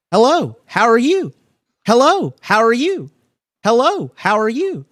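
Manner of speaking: put-on high, loud childlike call, repeated identically; boy's voice. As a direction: call out as an eerie little boy. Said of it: "you hear the boy's voice again".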